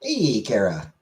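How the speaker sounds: normal talking voice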